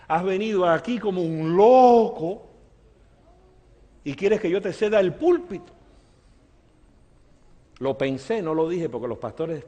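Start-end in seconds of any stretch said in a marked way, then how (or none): 0.1-5.7 higher-pitched mock indignation; acted-out incredulous objection; indignant retort. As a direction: object in disbelief, pitch lifted. Pastor's indignant unspoken reply.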